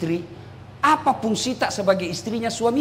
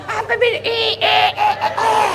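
mock squeal; 11 semitones higher than usual. Mocking women's vanity, squealing.

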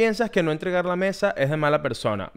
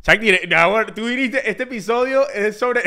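higher, slow exasperated shout; 4 semitones higher than usual. Real, not acted. Host protesting to his cohost, exasperated shout. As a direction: throw it up in disbelief.

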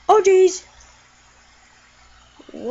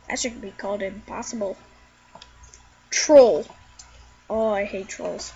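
ordinary delivery

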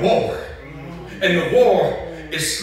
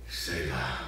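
"Selah" said very low and slow; a hushed, deep "Selah"; drawn-out Selah; 10 dB quieter than usual. Shown as a demonstration. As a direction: let "Selah" hang deep as a pause.

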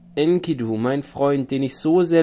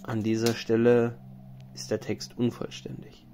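hushed aside; 3 semitones lower, 7 dB quieter than usual. A narrator's reading. Narrator quietly notes the tablet is incomplete, whispered. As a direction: drop to a near-whispered editor's note. Editorial note on missing text.

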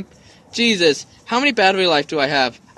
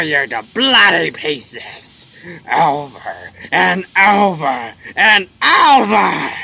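low, menacing game villain, swelling at the end; slow, throat-hurting monster threat; throat-straining villain voice; 5 semitones lower, slower than usual. Acted. Player voicing the villain's threat to tear him apart, menacing. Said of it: "my throat is hurting me for doing that".

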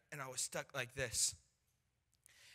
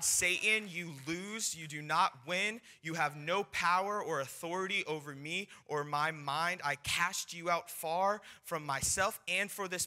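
raised, loud, commanding declaration; forceful rebuking prayer; 4 semitones higher, 5 dB louder than usual. Retold or imitated. Man of God casting out fear and Satan, forceful prayer. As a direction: pray with full force, casting out the enemy.

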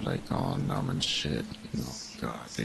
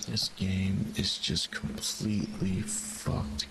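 1 slow drawn out; much slower than usual. Real, not acted. Frustrated at the game.

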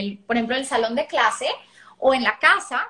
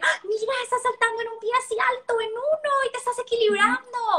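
higher, bright engaged parent voice; attentive describing; 10 semitones higher than usual. Shown as a demonstration. Parent watching child balance, noticing with interest. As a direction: notice and describe what the child does, delighted. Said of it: "es decirle".

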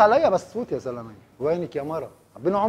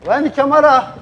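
louder, higher call for the wife; loud demo line; 5 semitones higher, 8 dB louder than usual. Shown as a demonstration. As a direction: show him how to shout وين الكاميرا with force. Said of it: "بعمل لك اياها انا قدامك مره". (نداء عالٍ وحاد)

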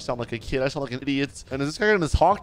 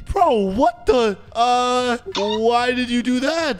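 high, stretched-out outcry of disbelief; shocked disbelief; 8 semitones higher, 3 dB louder, slower than usual. Acted. Gamer hears his cellmate's confession, shocked disbelief. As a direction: recoil in hammed-up shock, pitch staying way up.

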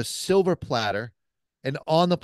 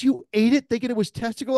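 amazed question; 10 semitones higher than usual. Retold or imitated. Buddy retold amazed.

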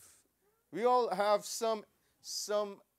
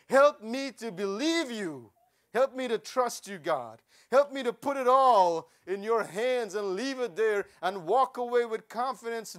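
building from raised to loud, pleading hard; fervent preaching; 3 semitones higher, 7 dB louder than usual. Acted. Preacher pleads with God for the congregation, building loud and fervent. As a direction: climb into a full-voiced plea to God.

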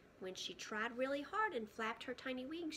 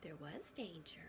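hushed storyteller whisper for the danger; breathless suspense whisper; 5 semitones lower, 6 dB quieter than usual. A narrator's reading. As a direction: whisper it like a secret so the child leans in. Suspense moment in bedtime story.

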